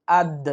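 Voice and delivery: আদ with a short echoing release on the d; qalqalah bounce. Shown as a demonstration. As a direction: bounce off the final দ in আদ. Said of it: "সহি শুদ্ধ উচ্চারণ হবে".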